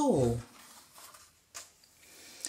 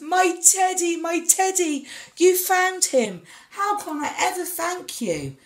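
much higher, loud joyful cries; overjoyed giant; 10 semitones higher, 5 dB louder than usual. A narrator's reading. Giant thanks Tom joyfully for finding his teddy. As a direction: burst out with delight at a found treasure.

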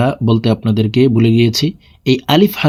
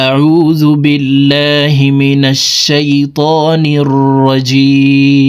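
very slow, drawn-out chanted recitation; Quranic recitation; 2 semitones higher, 3 dB louder, much slower than usual. Acted. Teacher opening the lesson with slow chanted recitation. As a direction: recite it slowly with long held vowels.

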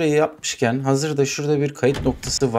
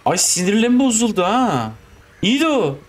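normal talking voice

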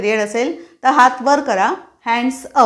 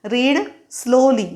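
slow teacher command; much slower than usual. Shown as a demonstration. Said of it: "teachers मुलांना usually सांगतात".

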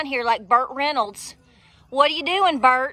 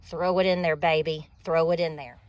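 playful low tease; 8 semitones lower, 3 dB quieter than usual. Real, not acted. Playful call while planting.

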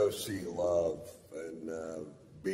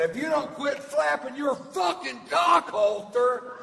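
high, loud yelled insult; furious shout; 6 dB louder than usual. Retold or imitated. Yelling at the woman talking in the theater, loud high shout. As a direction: scream it at her across the theater.

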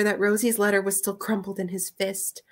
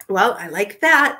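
indignant; 6 dB louder than usual. A narrator's reading. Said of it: "Pete thought".

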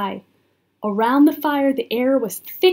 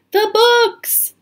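high, loud exclamation of dismay; alarmed cry; 11 semitones higher, 6 dB louder than usual. A narrator's reading. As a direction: cry out as a horrified story character. Jack alarmed at the fire.

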